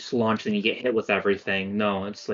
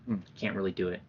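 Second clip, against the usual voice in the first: usual speaking voice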